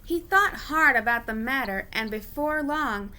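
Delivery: ordinary delivery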